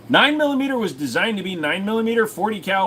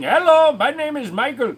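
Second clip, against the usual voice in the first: put-on louder, higher Indian accent greeting; mock scam caller accent; 8 semitones higher, 4 dB louder than usual. Imitating scam callers answering the phone, mock Indian accent. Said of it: "answering the phone and saying".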